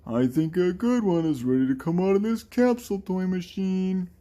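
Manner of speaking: very high put-on cartoonish voice; game character voice. Game character at the capsule toy machine, high put-on voice. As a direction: pitch it way up into a silly character voice. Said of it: "I'm trying to do these in the voices".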